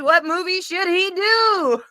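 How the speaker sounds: high, slow excited question; rally the viewers; excited outro. Real, not acted. End-credits outro asking viewers for movie ideas, excited and high. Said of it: "end-credits outro question".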